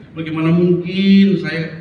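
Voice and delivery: acted slow refusal; devout woman's refusal. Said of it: "dia selalu bilang begini".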